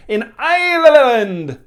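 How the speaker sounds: a terrible, hammed-up Irish accent; a jokey, badly faked Irish accent. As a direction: toss in a quick, clumsy Irish accent. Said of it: "That was a terrible Irish accent".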